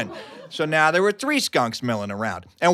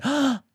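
gasp; 9 semitones higher than usual. Retold or imitated. Lands among skunks.

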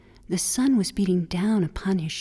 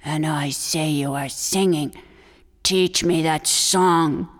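low, far-off coyote voice, growing louder; a low-voiced coyote taken with the song; greedy coyote wanting the song; admiring coyote; 5 semitones lower, 3 dB louder than usual. Coyote insists.

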